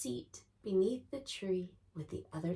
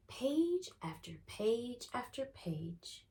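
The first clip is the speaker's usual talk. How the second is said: slow, higher storyteller lilt; drawn out; 5 semitones higher, 4 dB quieter than usual. A narrator's reading. Storyteller tells of reading page after page, drawn out. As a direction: stretch each repetition out slowly. Narrator draws out the repeated reading.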